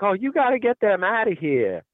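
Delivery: imitating an annoyed store lady demanding they leave; mock annoyed lady. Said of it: "she's like".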